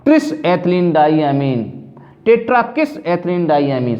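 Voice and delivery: very slowly, each word spelled out for the class; slow demo of names. Shown as a demonstration. Teacher dictating ligand names to students, very slow. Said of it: "दो के लिए bis लगाएंगे".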